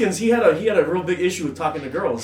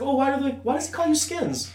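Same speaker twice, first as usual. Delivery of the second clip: put-on girl's question; 8 semitones higher than usual. Quoting the cute girl.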